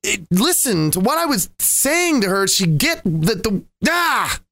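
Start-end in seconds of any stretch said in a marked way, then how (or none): 3.9-4.4 exasperated high "ah" burst; frustrated groan. Acted. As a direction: cut off with a frustrated cry. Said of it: "it's frustrating".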